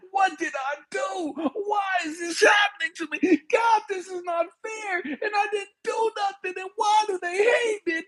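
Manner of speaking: high, anguished wail; crying out. Acted. Joseph crying to God from the ditch, high anguished pleading. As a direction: wail upward in one urgent run of questions. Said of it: "and he's crying".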